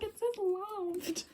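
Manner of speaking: slow, tearful, high wail; a retold hammed-up sob over the word loved; mock crying. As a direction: whimper it like heartbroken, slowly. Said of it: "And I was like".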